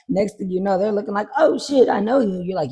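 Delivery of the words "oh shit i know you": high, loud start easing down; excited mock greeting, pitched up; acted surprised recognition. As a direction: play someone suddenly recognizing a face. Acted star recognizing the listener.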